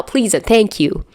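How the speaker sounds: ordinary delivery, nothing marked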